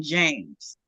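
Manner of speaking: normal talking voice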